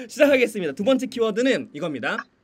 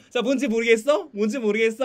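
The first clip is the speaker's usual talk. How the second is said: higher, louder sing-song taunt rising on 모르겠어; teasing; 5 semitones higher, 7 dB louder than usual. Shown as a demonstration. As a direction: needle the kids to guess. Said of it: "막 이렇게 약 올리면서". (모르겠어를 올리며 약 올리는 말투)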